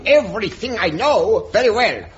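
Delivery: put-on ridiculous German accent. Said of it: "drop this ridiculous accent".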